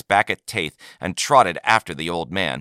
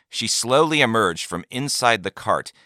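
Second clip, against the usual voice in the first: usual speaking voice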